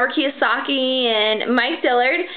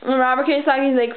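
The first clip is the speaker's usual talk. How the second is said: ordinary delivery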